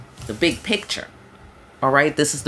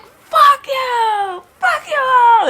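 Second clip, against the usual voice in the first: much higher, louder, slow yelled outburst; mock furious shout; 8 dB louder, much slower than usual. Reader imagines Jesus losing it at everyone, mock furious shout. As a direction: scream it like a snapping Jesus. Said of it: "was like".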